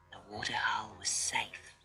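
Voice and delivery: soft low whisper; reassuring narrator whispering; hushed whisper. A narrator's reading. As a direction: breathe it out barely voiced.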